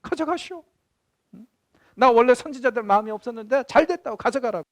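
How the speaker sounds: defiant retort. Retold or imitated. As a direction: fling it back like a cheeky child. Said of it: "예레미야가 뭐라고 그랬어요".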